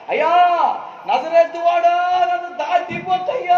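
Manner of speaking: high, slow, loud desperate cry. Retold or imitated. Preacher voicing the blind man crying to Jesus, a slow loud high cry. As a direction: cry out to Jesus not to pass you by. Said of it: "అని కేకలేశాడు".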